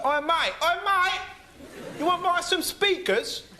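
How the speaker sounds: hammed-up street hawker yelling from a van; imitating a van shout. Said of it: "they'll shout at you going".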